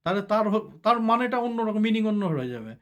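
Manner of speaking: usual speaking voice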